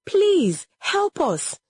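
high, pleading call for help. A narrator's reading. Children at the herbalist's place, calling out for help. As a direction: call out urgently with pitch soaring. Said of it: "they called out".

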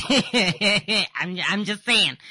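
loud teasing laugh into words. Real, not acted. Host teasing caller about sounding sad, laughing. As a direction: laugh it off, loudly.

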